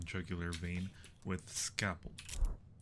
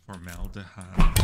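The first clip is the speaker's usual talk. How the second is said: normal talking voice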